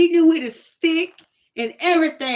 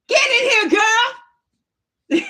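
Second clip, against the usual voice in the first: high yelling call, louder; grandma calling; 11 semitones higher, 4 dB louder, faster than usual. Retold or imitated. Grandma calling the girl back inside, hollering.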